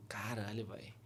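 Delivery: under-the-breath curse; whispered inner swear. Retold or imitated. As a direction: mutter it to yourself in shock. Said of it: "Aí eu".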